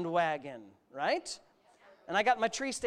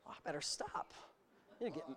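quiet aside; 5 semitones lower, 12 dB quieter than usual. Real, not acted. Aside after preaching peak.

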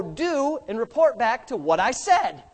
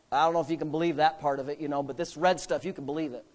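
mock-earnest, lower and softer; sarcasm; 8 semitones lower, 6 dB quieter, faster than usual. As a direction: say it with dry irony. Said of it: "That's sarcasm".

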